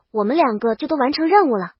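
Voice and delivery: plain reading voice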